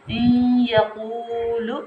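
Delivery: "इन यकूलु" slow, n merged into a long nasal y; ghunna on ya. Shown as a demonstration. Teacher showing correct reading, held nasal glide in "इन यकूलु". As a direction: draw "इन यकूलु" out through the nose. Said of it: "हमने पढ़ना".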